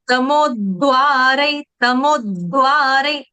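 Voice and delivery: तमोद्वारै slowly with a doubled द before वा; slow तमोद्वारै, pressure on the द; doubled d demo. Shown as a demonstration. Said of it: "देखो, double दो लेना है।".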